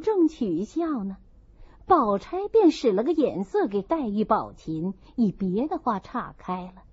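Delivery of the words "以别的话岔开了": ordinary delivery, nothing marked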